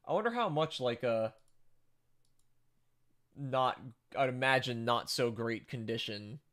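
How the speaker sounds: ordinary delivery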